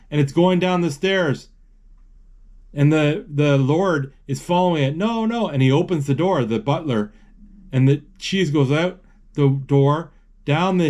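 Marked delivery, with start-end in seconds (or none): 5.0-5.5 high, mock-panicked no no; Lord's panicked no. Kid voicing the Lord chasing his cheese, mock-panicked.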